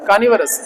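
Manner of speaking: कार्निवोरस said kaanivaras, r's silent, varas not vorus; कार्निवोरस with both r's silent and varas at the end; correct pronunciation. Shown as a demonstration. Teacher सही उच्चारण: कार्निवोरस, दोनों आर silent. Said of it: "दोनों आर silent है".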